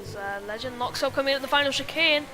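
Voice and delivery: loud, rising race call; motorsport commentator. Real, not acted. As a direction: call the overtake as it happens. Live race play-by-play.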